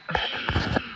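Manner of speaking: soft, breathy exhale; quiet release of breath; wordless sigh. Real, not acted. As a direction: let out a sigh. Pause before resuming.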